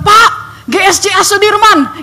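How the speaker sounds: high, slow urgent call; calling to a driver. Retold or imitated. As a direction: hail the driver with the destination. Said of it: "saya bilang".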